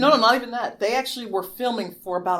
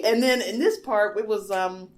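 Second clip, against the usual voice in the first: ordinary delivery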